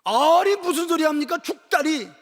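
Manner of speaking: high, loud indignant objection; acted outraged protest; rebuking. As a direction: scold in disbelief, voice jumping up. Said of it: "꾸짖은 거예요".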